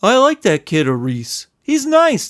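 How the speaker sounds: a shy big guy's sheepish voice; lightly sheepish Lump. Acted. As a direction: say it timidly. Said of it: "stated sheepishly".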